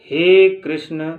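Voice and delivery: हे कृष्ण called slow and loud, vowel stretched; prolonged call. Shown as a demonstration. Teacher showing pluta, calling हे कृष्ण slowly and loudly. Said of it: "लंबे समय तक किसी को हम आवाज़ देते हैं".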